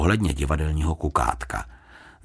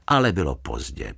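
usual speaking voice

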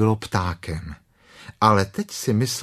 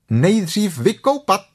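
higher, slow cheerful mother; cheerful Kanga; 10 semitones higher, 4 dB louder than usual. Acted. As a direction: sing it out brightly like a jolly mum. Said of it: "řekla klokanice vesele". (vesele, vyšším hlasem)

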